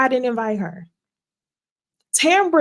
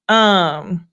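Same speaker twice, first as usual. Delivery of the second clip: usual speaking voice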